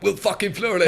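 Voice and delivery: high, loud gushing hype; hyped production crew. Retold or imitated. Production crew hyping up a fighter, loud and high. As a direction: gush like a star-struck crew member. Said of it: "all the production crew were like".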